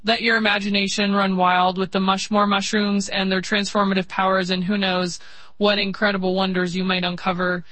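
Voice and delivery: ordinary talk, nothing marked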